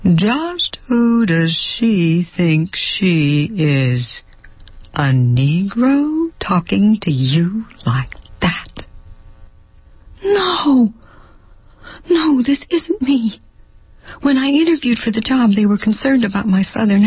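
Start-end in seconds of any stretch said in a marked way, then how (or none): 0.0-8.9 slow, whispered sinister inner voice. Acted. Her prejudiced inner voice hissing at her, slow and whispered. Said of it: "It has a voice". 10.2-13.4 high cry of no; horrified denial. Acted. She recoils from her inner voice with a high cry. Rejecting her own thought.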